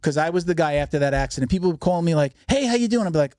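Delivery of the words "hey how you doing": much higher, friendly phone hello; lightly mock an upbeat friend checking in; cheery caller. Friends phoning him after the crash, mock cheery hello.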